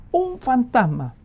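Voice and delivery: high, loud, slow cry of fright; fearful shout. Acted. Disciples in the boat shouting in fear. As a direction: shout it out in panic. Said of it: "gritaron de miedo".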